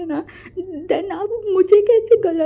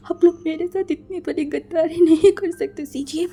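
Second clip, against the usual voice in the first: sobbing plea; 3 semitones higher than usual. Acted. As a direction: plead brokenly as if heart is shattering. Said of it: "फूट फूट के रो रहा था".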